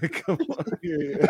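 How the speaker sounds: high mocking voice.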